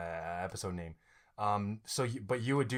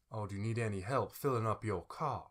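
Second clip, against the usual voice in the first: non-rhotic Southern gentleman, softer; Southern gas attendant; 3 semitones lower, 7 dB quieter than usual. Acted. Attendant offering help to drivers, non-rhotic Southern accent. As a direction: play the helpful R-dropping Southerner. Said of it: "you come out and, like, uh, you're like".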